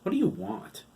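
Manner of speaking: "What do you want?" thrown out sharp and high. Acted. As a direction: cut him off with a sharp question. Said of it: "His tone was a little sharp".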